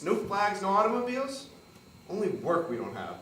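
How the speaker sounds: loud, high, indignant tirade; angry rant. Acted. Rodolfo to Catherine, loud angry rant. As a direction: hurl each question back at her.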